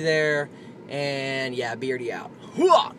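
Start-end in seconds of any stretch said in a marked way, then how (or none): 2.6-2.9 high, loud army battle cry; loud gung-ho soldier. Acted. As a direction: bark it like a pumped-up soldier.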